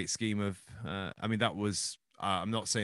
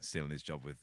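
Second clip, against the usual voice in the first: ordinary talk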